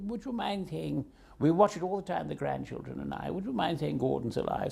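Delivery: hushed, courteous mock-royal request; the Queen's request. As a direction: softly imitate a royal asking a favour. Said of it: "You know, the Queen".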